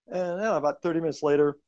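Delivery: ordinary talk, nothing marked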